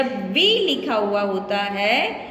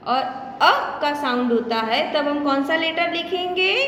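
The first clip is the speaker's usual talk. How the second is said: ordinary talk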